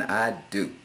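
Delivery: ordinary talk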